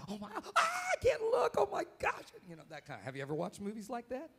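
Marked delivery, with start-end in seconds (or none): 0.1-2.2 high frightened yelps at a horror scene; scared moviegoer. Retold or imitated. Pastor acting out a scared viewer at a thriller, high frightened yelps. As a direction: squeal like you can't bear to watch. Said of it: "The kind that, you".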